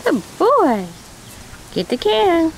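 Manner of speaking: high sing-song pet voice; teaching a parrot. Real, not acted. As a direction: coax the bird, high and sweet. Coaxing a parrot to talk.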